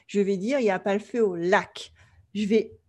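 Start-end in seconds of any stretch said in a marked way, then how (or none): 0.6-1.9 final stress on "lac", the rest even; French stress on lac. Shown as a demonstration. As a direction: Parisian French rhythm, with the weight on the last syllable "lac". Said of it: "moi, en tant que Française".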